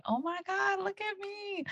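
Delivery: high squealed, hushed amazement; retold high-pitched "oh my God"; amazed self-talk. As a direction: squeal softly in awe at yourself. Retold inner amazement at own progress.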